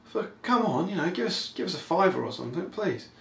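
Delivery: high, comic wheedling; annoyed pleading. Retold or imitated. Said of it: "looking a bit annoyed, going".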